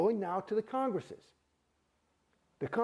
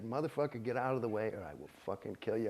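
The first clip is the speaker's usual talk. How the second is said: low, menacing, a bit softer; low threat; 9 semitones lower, 5 dB quieter than usual. Retold or imitated. Him threatening the official blocking his exit, low and menacing. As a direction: growl it low like you mean it.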